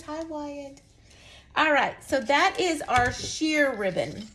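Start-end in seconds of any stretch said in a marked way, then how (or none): 0.0-0.8 soft, gentle greeting, much softer; soft group greeting relayed to a toddler; sweet hello to a child. Retold or imitated. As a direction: coo a hello to the little boy. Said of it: "Everybody says".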